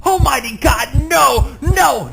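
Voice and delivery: high, loud anguished protest; loud desperate denial; frantic cry. Acted. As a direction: cry out in mounting terror. Frantic narrator in Tell-Tale Heart.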